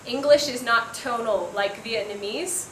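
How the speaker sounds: usual speaking voice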